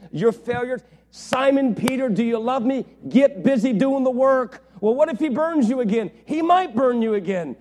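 loud, high, driving preacher's cry; full-force preaching. Acted. Preacher pressing Peter's story on the congregation, full force. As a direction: preach it out at the top of your voice.

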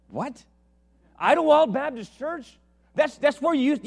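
high, loud amazed repeat; thrilled at the coincidence; delighted surprise. Retold or imitated. Preacher hearing her home church, high loud surprise. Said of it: "She goes".

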